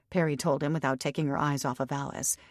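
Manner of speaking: usual speaking voice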